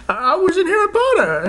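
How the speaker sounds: high, hammed-up washed-up actor voice; mock an ageing star clinging to old fame; Daniel Radcliffe. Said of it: "he'll be like".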